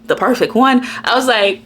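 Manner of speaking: normal talking voice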